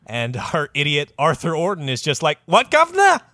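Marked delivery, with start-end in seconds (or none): 2.4-3.2 very high, loud mock Cockney; hammed-up Cockney playing dumb; Cockney accent. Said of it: "Full Cockney".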